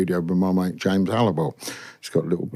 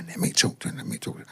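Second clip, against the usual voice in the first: hushed, urgent plea to hand over the phone; a pressing whisper, fading away at the end; 9 dB quieter, faster than usual. Retold or imitated. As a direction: whisper it fast, as if someone else is on the line.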